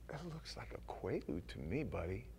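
very soft, understated threat; hushed, knowing cop; cop's quiet menace. Acted. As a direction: say it low-key, like a cop who's caught you. Cop quietly confronts him.

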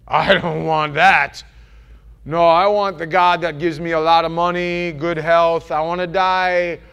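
loud, drawn-out mock protest; mock refusal. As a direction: belt out a spoiled believer's objection.

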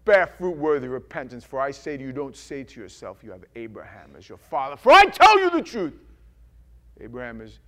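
stern prophet voice; lightly acted John the Baptist. John the Baptist warning the crowd, stern. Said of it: "and he goes".

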